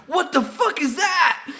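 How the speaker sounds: high laughing yell of surprise; stunned outburst. Retold or imitated. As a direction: shout it in shocked, laughing disbelief. Retelling shock at the radio.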